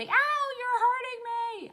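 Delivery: very high, drawn-out mock wail; loud, slow, squealed kid's complaint; imitating upset child. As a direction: yelp it like a child mid-meltdown. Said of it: "She'll say".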